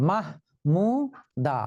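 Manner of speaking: মাহমুদা slow, মাহ-মু-দা one beat each; teacher sounding out the student name মাহমুদা syllable by syllable; syllables split. Shown as a demonstration. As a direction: beat out মাহমুদা in three parts. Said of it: "তিনটি অক্ষর আছে এখানে".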